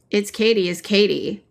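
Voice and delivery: ordinary talk, nothing marked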